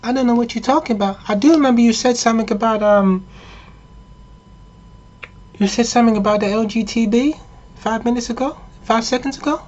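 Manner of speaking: high falsetto, put-on girlish voice; sustained high mock-female voice; mock-female girlfriend. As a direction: play innocent in a high woman's voice. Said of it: "And the thing goes".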